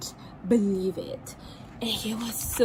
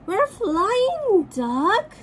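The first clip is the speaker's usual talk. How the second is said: high, bright squeak of surprise; a small creature amazed; dragonfly surprised at Duck; 9 semitones higher, 4 dB louder, much slower than usual. Acted. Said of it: "says the dragonfly in surprise".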